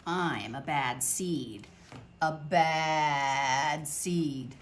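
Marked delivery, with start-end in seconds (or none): none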